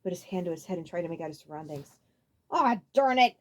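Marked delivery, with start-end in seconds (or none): 2.5-3.4 Abrax voice. A narrator's reading. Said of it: "an echoey voice said".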